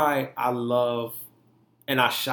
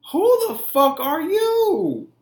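high, loud, drawn-out challenge; slow, raised-voice demand to himself; indignant self-confrontation; 13 semitones higher, 6 dB louder, much slower than usual. Retold or imitated. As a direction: confront your own reflection, incredulous and loud. Retold confrontation with himself in mirror.

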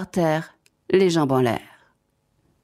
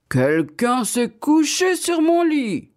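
slow, raised, booming-bear outcry; big bear's great big voice; 8 semitones higher, slower than usual. A narrator's reading. Big bear finds his bed slept on, in a great big voice. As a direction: make it a huge bear's drawn-out complaint. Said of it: "de sa grosse, grosse voix".

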